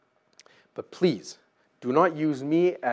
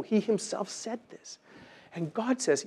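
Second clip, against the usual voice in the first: usual speaking voice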